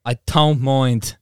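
slow, drawn-out mock Irish; Irish accent with lisp. Shown as a demonstration. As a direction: lay on the Irish slowly, lisping. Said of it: "do an Irish accent with a lisp".

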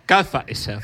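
mock Cockney accent, comic impression; Michael Caine. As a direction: put on a hammed London accent. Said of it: "in your Michael Caine accent".

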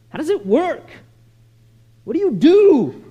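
mock-desperate, rising in force; hammed-up pleading bewilderment; insistent questioning. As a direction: press for an answer, last question loud and high. Acts out a girl's insistent questions.

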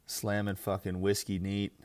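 normal talking voice

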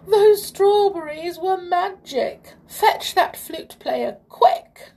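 high, loud, panicked king; gasping alarm. A narrator's reading. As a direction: cry out in shock and order urgent help. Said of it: "he gasped".

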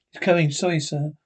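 ordinary talk

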